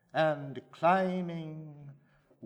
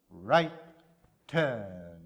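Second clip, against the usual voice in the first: loud military order, falling hard on turn; barked command; 6 semitones lower, 5 dB louder than usual. Acted. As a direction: snap it out like a drill sergeant. Soldiers marching visitors through tomb.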